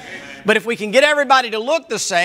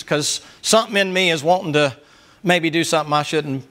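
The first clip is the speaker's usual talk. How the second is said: usual speaking voice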